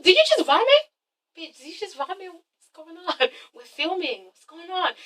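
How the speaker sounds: mock hushed alarm. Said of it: "Nicki's like".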